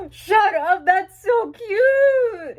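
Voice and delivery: overwhelmed fan shrieking at something adorable; excited squeal. Real, not acted. Fan opening an album, squealing at the cute photo.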